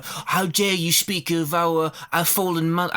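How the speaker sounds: put-on hammy British accent sliding into Cockney; Mira. Mira rebuking someone over their fallen mother, badly faked British accent. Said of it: "Mira has a British accent in this".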